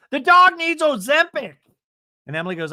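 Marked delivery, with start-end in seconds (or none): 0.1-1.6 high, loud catty impression; mock Tamara. Host mimicking Tamara mocking the dog, high and loud. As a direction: blurt the dig in a high voice. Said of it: "And Tamara goes".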